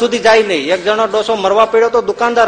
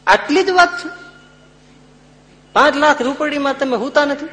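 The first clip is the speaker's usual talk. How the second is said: scoffing wife; 9 semitones higher than usual. Retold or imitated.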